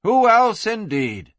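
high start, dropping on "indeed"; knowing echo. A narrator's reading. Narrator echoes the madam to the listener, knowing echo.

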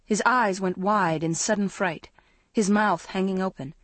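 ordinary delivery

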